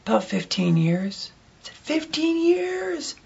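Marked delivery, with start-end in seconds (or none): 1.8-3.2 high, soft, very drawn-out disbelief; mock astonishment. Diner echoing the waitress's answer, high astonished drawl. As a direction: stretch it out in a high, amazed voice. Re-enacts amazement at her answer.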